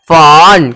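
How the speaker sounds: फन very slow, as "faaawn"; long O sound. Shown as a demonstration. Teacher demonstrating the O sound, फन drawn out slowly. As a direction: stretch the aw of फन right out before the n. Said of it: "ओ का जब sound निकलता है".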